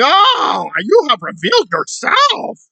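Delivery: mocking her outrage over the rainbow; mock offended. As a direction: parody a dramatic accusation.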